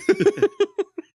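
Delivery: real high-pitched giggle. Real, not acted. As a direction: burst into a squeaky laugh. Laughing at the quote.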